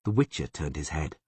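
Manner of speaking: plain reading voice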